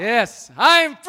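high, loud shout; booming motivational speech. Real, not acted. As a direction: cheer it out to the crowd. Leading crowd chant.

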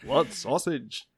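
slow, drawn-out fake British accent; mock British accent. Copying how they sound over there, slow mock-British accent. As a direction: echo it slowly as a caricature Brit. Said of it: "that's exactly how they sound over there".

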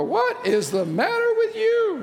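high, slow mock bewildered question; puzzled onlooker. Onlooker baffled by someone smiling, in mock bewilderment. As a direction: play a baffled bystander. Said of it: "People go".

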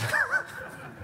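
high burst of laughter. Real, not acted. After winning connection.

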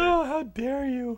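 high, slow, loud mock offense; hammed-up indignant gasp; mock outrage.

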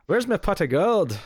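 slow, high mock-Irish leprechaun lilt; bad Irish accent. Teased as a leprechaun, hammed-up Irish accent. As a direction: ham up a stretched-out Irish accent. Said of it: "in an Irish accent".

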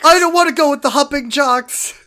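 whiny protest. Acted. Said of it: "voicing Jesse, whiny".

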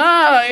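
hammed-up yawn; fake yawn. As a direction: act out yawning for onlookers. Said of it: "I'm practicing my yawn".

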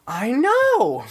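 high, loud excited squeal; excited agreement. Retold or imitated. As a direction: squeal it with delight. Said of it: "you're like".

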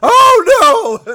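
loud, high cry of dismay; big comic wail of alarm; mock panic cry. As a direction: yell it in hammed-up horror. Acts out dropping the script.

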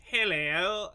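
over-the-top posh English greeting; posh English accent. Retold or imitated. Imitating an actor in a war movie, exaggerated posh English accent. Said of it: "Mike Myers ... where he shows up, he's like".

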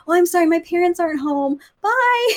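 put-on high, cutesy little-kid voice; pretend child. Woman fooling a salesman at the door, childlike. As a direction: fob them off in sweet singsong, bye pitched right up. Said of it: "if a salesman comes to my door".

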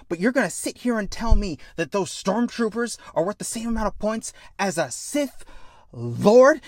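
heated, high-pitched persuasion; impassioned challenge. Acted. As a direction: press the friend hard with incredulity. Kid challenges warrior's belief.

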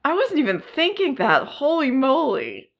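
high, startled realization; surprised. Real, not acted. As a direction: caught completely off guard. Caught off guard by sequel news.

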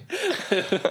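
high mock voice. Mocking the word.

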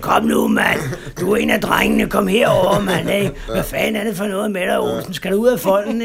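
loud, rough-voiced tempter egging him on; Charles Bukowski. The drinking half of his head tempting him, loud and coaxing. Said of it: "det var Charles Bukowski".

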